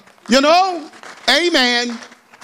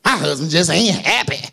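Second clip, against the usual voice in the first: low, mopey mock complaint; lightly voiced grumbling wife; sulky complaint; 5 semitones lower than usual. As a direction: moan it like a fed-up wife. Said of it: "Why you talk like that?".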